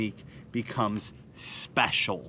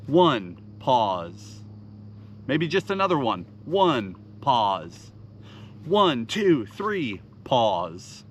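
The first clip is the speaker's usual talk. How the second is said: each "pause" falls well lower and lingers; counting cadence; 3 semitones lower, slower than usual. Shown as a demonstration. Showing anglers the jerkbait rhythm, counted cadence. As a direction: count the rips, then drop low and slow on "pause". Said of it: "And then I pause".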